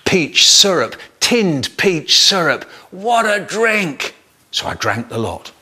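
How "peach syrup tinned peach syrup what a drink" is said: slow, relished words rising to high glee; savouring delight. Acted. Boy tasting peach syrup in secret, delighted. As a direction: revel in a delicious discovery.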